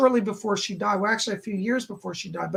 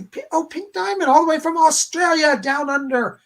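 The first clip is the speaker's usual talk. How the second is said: excited greeting; 7 semitones higher, 6 dB louder than usual. Real, not acted. Greeting a viewer's name excitedly.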